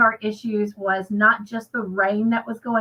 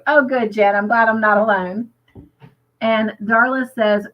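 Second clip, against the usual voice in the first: normal talking voice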